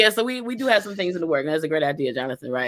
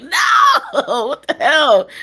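laughing shout; 7 semitones higher, 5 dB louder, slower than usual. Retold or imitated. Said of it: "I'm like".

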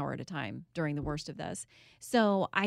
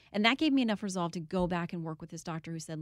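usual speaking voice

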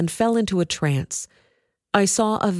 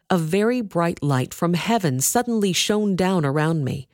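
usual speaking voice

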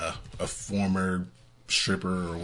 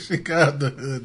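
excited; 8 semitones higher, 6 dB louder than usual. Real, not acted. Joking echo, loud and high.

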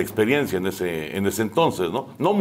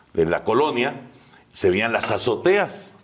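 ordinary delivery

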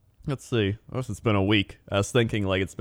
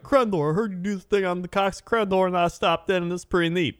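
much higher voice for a viewer; imitated viewer; 9 semitones higher than usual. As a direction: mimic a chatty stream viewer. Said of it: "they're like".

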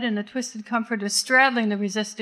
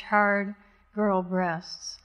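ordinary delivery

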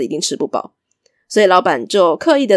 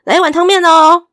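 much higher and louder, calling the order; 8 semitones higher, 8 dB louder than usual. Acted. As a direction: belt the order to the kitchen. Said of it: "一边大声的喊说". (大声喊向厨房)

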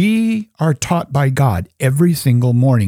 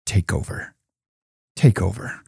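hushed prayer; 5 semitones lower, 6 dB quieter than usual. Shown as a demonstration. Modelled surrender prayer.

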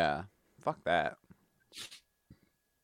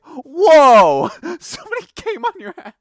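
loud, high startled whoa; mock shocked shout; 12 semitones higher, 13 dB louder than usual. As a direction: act out a shocked gasp. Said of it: "came back and been like".

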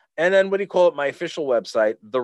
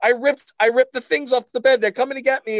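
high, loud mock-panicked confession; hammed-up kid panic, pitched way up; crying, panicked kid; 13 semitones higher, 6 dB louder than usual. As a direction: imitate a frantic child pleading with mom. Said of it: "and I'm crying".

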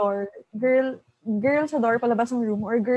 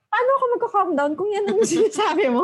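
higher, louder fed-up comeback; exasperated retort; 8 semitones higher, 4 dB louder than usual. Retold or imitated. Woman annoyed at the friend who keeps saying calm down, higher and louder exasperation. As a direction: throw it back at her, exasperated. Angry reply to creepy friend.

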